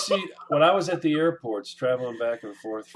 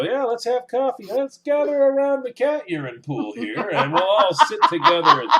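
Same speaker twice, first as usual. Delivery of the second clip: higher, louder mock hostess voice, laughing through; mock polite guest; 6 semitones higher, 6 dB louder than usual. To co-host mocking a cat owner's visit, laughing mock cheer. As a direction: ham up the cheery guest, cracking up. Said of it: "I don't wanna sit down and go".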